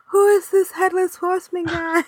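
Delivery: high-pitched imitation of a baffled friend; hammed-up high voice of bafflement; mock bewilderment. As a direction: pitch it way up, acting puzzled. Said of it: "He was going".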